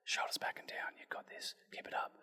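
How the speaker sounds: soft encouraging whisper in the ear; whispering coach. Retold or imitated. Quiet coach whispering encouragement into a client's ear. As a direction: whisper the cues like a gentle coach right at their ear. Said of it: "in the ear going".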